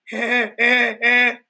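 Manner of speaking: mock giggle. Girl giggles reluctantly.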